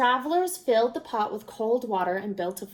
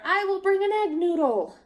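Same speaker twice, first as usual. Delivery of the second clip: higher, louder boast; eager cry; 9 semitones higher, 5 dB louder than usual. A narrator's reading. Villager refusing to be outdone, crying out high. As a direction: outdo the neighbour. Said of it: "cried another".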